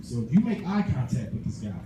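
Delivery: ordinary talk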